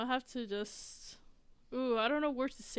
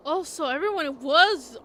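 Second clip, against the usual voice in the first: excited outburst; 4 semitones higher, 11 dB louder than usual. Real, not acted. Sudden realization in game.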